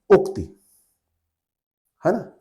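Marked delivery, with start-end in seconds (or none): 0.1-0.4 उक्ति higher, with brief final इ; correct short vowel. Shown as a demonstration. Teacher repeating the right word, pronunciation demo. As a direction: pitch उक्ति up and cut the last i short. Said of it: "क्या सही शब्द होता है?".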